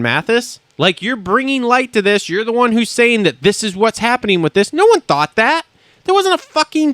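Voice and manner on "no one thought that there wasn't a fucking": high, exasperated outburst; indignant rebuttal. Real, not acted. Host rebutting a fellow podcaster's claim, indignant. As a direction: shoot it down with the voice jumping up.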